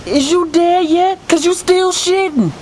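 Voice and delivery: very high, slow taunting voice; mock taunt. Taunting the victim in a story.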